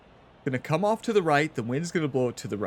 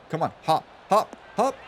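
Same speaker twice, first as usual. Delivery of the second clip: rising, louder urging; urging the ball; 2 semitones higher, 4 dB louder than usual. Real, not acted. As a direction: come on, hop, hop, hop.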